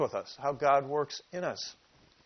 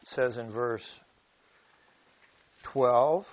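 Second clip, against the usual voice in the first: ordinary talk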